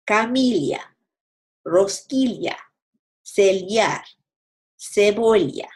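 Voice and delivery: ll in Fallecer, orilla, bolsillo said as l plus y; ly sound for ll. Shown as a demonstration. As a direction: ll as ly, old Castilian style. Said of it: "Now the L sound".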